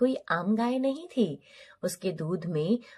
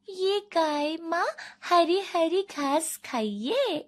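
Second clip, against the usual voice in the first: put-on higher-pitched, slow, drawn-out girl's voice; young girl coaxing the cow; 7 semitones higher, slower than usual. Girl offering grass to her cow mother, sweetly drawn out. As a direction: offer it slowly in a high sing-out.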